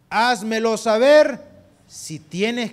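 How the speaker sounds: loud, slow, stern challenge; booming voice of God. Retold or imitated. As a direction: thunder it slowly like God rebuking. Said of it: "Y dice la siguiente parte". (voz de Dios, fuerte y lenta)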